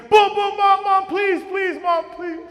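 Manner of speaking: high, loud calls to Mom through the door; desperate pleading shout. Retold or imitated. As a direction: beg her to open up, shouting. Boy banging on door, begging.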